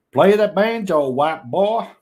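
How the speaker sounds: mock country holler. Streamer calling for banjo music, loud mock Southern holler. Reads out banjo jibe in character.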